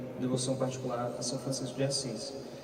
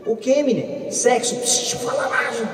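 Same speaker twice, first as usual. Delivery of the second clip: higher and much louder, a hammed-up family shouting match; loud mock exchange; 12 semitones higher, 9 dB louder than usual. Mother and son yelling about the topic, hammed-up loud. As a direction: play both sides at full volume.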